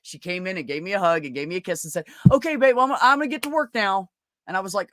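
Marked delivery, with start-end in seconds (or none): 2.3-4.1 high and louder, eager to please; mock sweet girlfriend. The girlfriend kissing him goodbye, high and overly eager. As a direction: chirp it up high like a cowed partner.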